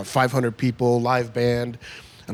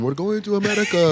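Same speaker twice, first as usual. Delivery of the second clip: excited father; 9 semitones higher than usual. Retold or imitated. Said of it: "ran into the hospital room".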